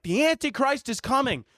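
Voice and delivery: high-pitched mock doom proclamation; street-corner doomsayer. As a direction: announce doom to passers-by, high and urgent. Street-corner doom preacher quoted.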